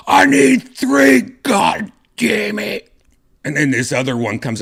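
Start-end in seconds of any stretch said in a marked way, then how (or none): 0.1-2.9 much higher, loud and slow, exasperated; strained yell of a doctor at the end of his rope; frustrated yell. Retold or imitated. Doctor yelling at a stewardess who brought one tray, exasperated high yell.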